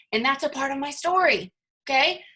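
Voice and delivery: ordinary talk, nothing marked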